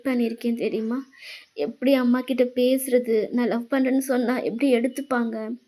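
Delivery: soft, halting, emotional thanks; overwhelmed, pausing to find the words; tearfully grateful. Acted. Sister thanking her brother for winning mother's permission, softly overwhelmed. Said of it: "ஹக் பண்ணிட்டு செம்மையா அலறீங்க".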